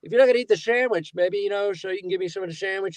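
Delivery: quick, roundabout Diner mooch impression; Paul Reiser. Diner pal angles for a sandwich, mock wheedling. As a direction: wheedle fast around the request. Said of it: "Paul Reiser".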